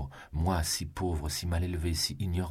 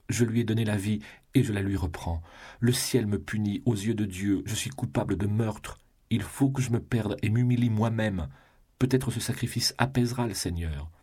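desperate confession; 6 semitones higher than usual. A narrator's reading. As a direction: pour it all out, rising in despair. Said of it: "s'écria sa femme à genoux". (aveu désespéré, plus haut et plus fort)

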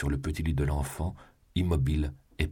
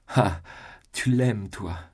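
much higher and louder, overwhelmed relief; sudden outburst; 6 semitones higher, 5 dB louder, slower than usual. A narrator's reading. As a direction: burst out, almost crying the words. Mother's sudden outburst of joy. (éclat soudain, bien plus haut et plus fort)

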